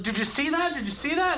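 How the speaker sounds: high, eager showing off; excited bragging. Retold or imitated. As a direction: brag about the strike, high and eager. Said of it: "and I was like".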